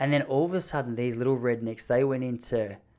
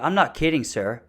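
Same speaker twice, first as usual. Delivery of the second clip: emphatic put-on American woman, a bit louder; North Dakota wife; 3 semitones higher, 4 dB louder than usual. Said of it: "she's from North Dakota".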